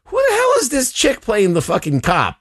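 high-pitched retold disbelief; baffled outburst. Viewer recounting his reaction while watching the movie, incredulous. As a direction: blurt it out, mock-baffled by the casting.

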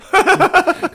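sharp high burst of laughter. Real, not acted. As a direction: breaks up laughing. Laughing at Brett's text.

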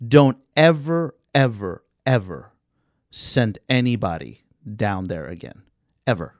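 slow, drawn-out repeated ever; emphatic warning. Retold or imitated. Customer warning the advisor never to send anyone there, slow and emphatic. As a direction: lean hard on each ever as a warning. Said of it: "words of advice".

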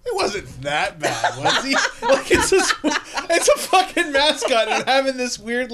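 laughing while talking. Real, not acted. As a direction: let the laugh carry the words. Laughing through comment.

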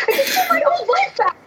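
high, half-laughing, half-crying wail; hysterical wail. Acted. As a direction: cry out in desperate hysteria. Terrified before monster.

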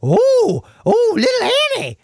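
mocking a squealing actor in a very high voice; mock actor line. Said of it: "the actor comes out and says".

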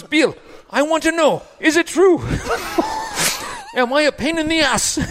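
put-on French accent, pitch way up; agitated French accent. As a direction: beg for the truth in a French accent. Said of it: "less understandable when he's a little excited".

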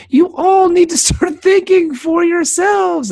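high, slow mock-earnest preacher voice; imitating Brian. Brian preaching to the crowd outside, mock-earnest. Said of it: "he comes out of his mom's house and he's like".

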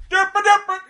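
very high, loud derp yell; loud mock Jimmy. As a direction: blast it out like a loud oaf. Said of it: "'cause he's really loud".

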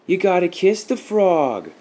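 put-on high, loud, drawn-out kid's taunt; goading. A narrator's reading. Boy in the pool dares Nikki to kiss it, goading. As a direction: egg her on slowly from across the pool. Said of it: "Joey Harrington goaded from the middle of the pool".

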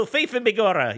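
cartoon Irish brogue for a radio ad; Irish cop accent. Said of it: "this friendly Irish cop".

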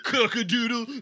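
rooster crow. Acted. Rooster taunt.

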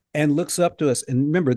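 ordinary talk, nothing marked

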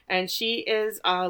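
ordinary talk

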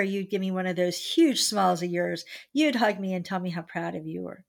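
ordinary talk

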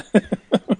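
short uneasy laugh; awkward nervous chuckle. Real, not acted. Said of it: "that was a nervous laugh".

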